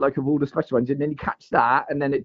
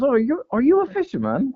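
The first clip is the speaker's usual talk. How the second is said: higher, mock cheery woman asking; playful imitation of a curious passer-by, higher; paddleboarder woman; 12 semitones higher than usual. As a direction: pitch it up like the lady on the paddleboard. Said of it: "she goes".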